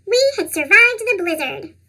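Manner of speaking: plain reading voice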